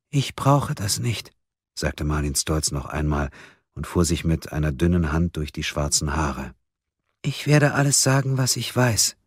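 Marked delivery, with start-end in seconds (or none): 0.1-1.3 slow, high, fragile voice; timid suspect. A narrator's reading. Fragile suspect repeats timid refusal. 7.2-9.2 high, small, careful voice; fragile suspect. A narrator's reading. Fragile suspect offers to confess.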